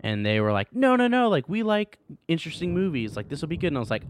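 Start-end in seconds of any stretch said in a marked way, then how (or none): 0.8-3.7 high-pitched, breezy mock reassurance; imitating insistent parents. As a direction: wave away the worry in a raised parent voice.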